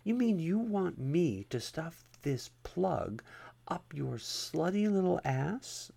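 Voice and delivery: slow, mock-innocent whisper; innocent tease. A narrator's reading. Officer teasing the begging man, mock-innocent and whispered. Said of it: "she asked innocently".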